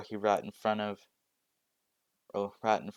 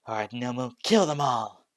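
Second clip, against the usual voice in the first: rising to a yell, unleash the monster; villain order; 3 semitones higher, 7 dB louder than usual. Acted. Shigaraki ordering Nomu to attack, building to a shout.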